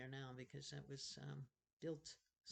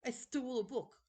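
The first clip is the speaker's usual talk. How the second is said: put-on boy's voice; 9 semitones higher, 9 dB louder than usual. Colin voiced, boy.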